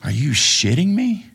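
high incredulous squeak; can't believe what you just heard. Retold or imitated. Retold disbelief.

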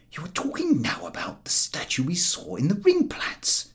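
whispered, soothing; Harris playing along. A narrator's reading. Harris humouring George, whispered. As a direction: hush it like humouring a patient. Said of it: "Harris said".

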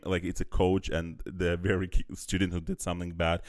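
ordinary talk, nothing marked

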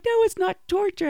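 high, protesting reply; playful high protest; defensive protest. Retold or imitated. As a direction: pitch it up in mock defence. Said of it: "And I'm like".

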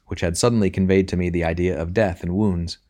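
ordinary delivery

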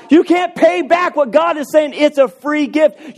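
ordinary delivery

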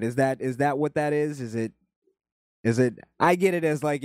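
ordinary talk

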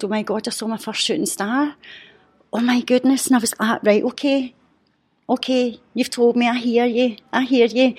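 giddy, overwhelmed delight; thrilled. Retold or imitated. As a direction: relive the moment of joy. Recalling the shooting star excitedly.

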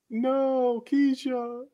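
high, drawn-out mock wail; mock anguished cry. Viewer voicing Susan watching Keisha drown, mock wailing. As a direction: stretch it out as a high, helpless cry.

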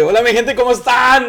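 a loud, high, excited show-host hello; showing the greeting. Shown as a demonstration. Co-host shows the host how the greeting goes, loud and high. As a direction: sell the opener to your people. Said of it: "No, se dice".

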